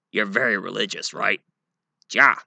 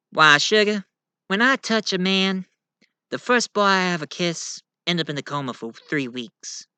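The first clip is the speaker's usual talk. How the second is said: higher, lightly Southern belle voice; Rogue's Southern accent; 8 semitones higher than usual. Acted. Rogue explaining her touch, lightly Southern. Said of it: "This here is Rogue".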